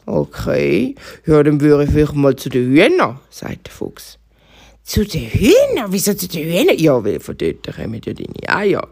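fox pointing the hare to the hens. Acted. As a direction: advise the bunny like a crafty fox. Fox advises bunny.

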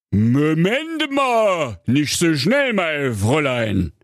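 drawn-out, high put-on dad voice; slow, chiding fairy-tale papa; admonishing father. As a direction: stop her short with long stretched words. Voices father halting his daughter.

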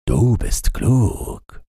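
lightly voiced reindeer. A narrator's reading. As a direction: flatter the wise woman slowly. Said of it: "sagte das Rentier".

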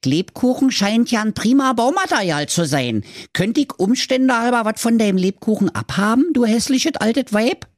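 high, cheeky piglet voice; little pig. A narrator's reading. Pinky asks the witch for gingerbread, piglet voice. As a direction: ask in a high, insistent pig voice. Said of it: "sprach da die Pinky".

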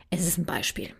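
unvoiced whisper; whispered aside. Real, not acted. Speaker reminds listeners it's only an example, whispering. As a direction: slip it in as a hushed aside.

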